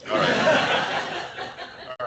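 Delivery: high, loud laughing all right. Real, not acted. Speaker recovering from laughter with the audience, laughing. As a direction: laugh it out, real. Laughing after the joke lands.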